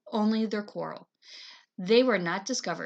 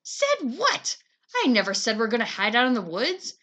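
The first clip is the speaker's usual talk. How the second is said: yelling; 2 semitones higher, 6 dB louder than usual. Acted. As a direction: shout back at him. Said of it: "Claudia was yelling too".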